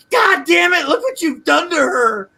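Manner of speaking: high, loud mock outraged scream; imitated scream. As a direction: scream it in outrage, mimicking her. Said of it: "she screams out".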